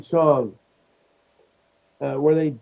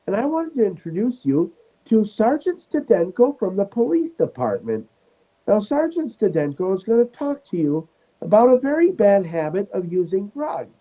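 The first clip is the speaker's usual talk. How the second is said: high, prim sketch-nun voice; Sister Mary Elephant; 6 semitones higher than usual. Retold or imitated. Nun introducing a police sergeant to class, high put-on voice. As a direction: introduce a guest in a high mock-teacherly lilt. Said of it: "she, she goes".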